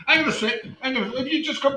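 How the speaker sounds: bursts out laughing, then talks through it. Real, not acted. Host laughing at a guest's odd look. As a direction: laugh hard, then get the words out.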